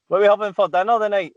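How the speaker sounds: put-on child asking mum. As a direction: pipe up quickly, pestering mum about dinner. Kid asking mum, acted.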